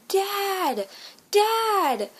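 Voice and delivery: high, loud call to dad. Retold or imitated. Child calling to a father on the beach, calling out. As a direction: cry out for your father, drawn out high.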